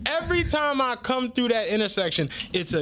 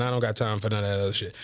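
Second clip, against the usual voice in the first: ordinary talk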